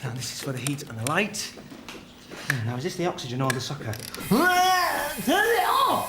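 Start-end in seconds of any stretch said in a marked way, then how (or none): 4.3-6.1 drawn-out, high, loud cry of panic; panicked shout. Acted. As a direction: yell at the machine to stop. Machine goes wrong, panics.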